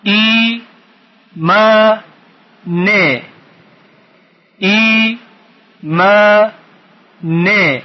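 "ای، ما، نه" in separate beats, long ee and aa; syllable by syllable. Shown as a demonstration. Teacher splitting a word for pupils, syllable by syllable. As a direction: chant each syllable alone with pauses between. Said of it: "بخش تفکیک کنیم و بخونیم".

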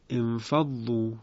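slow انفضوا begun with a clear e sound; starting with kasra. Shown as a demonstration. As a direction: begin انفضوا slowly as enfaddu. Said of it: "از کلمهٔ دوم آغاز کنیم".

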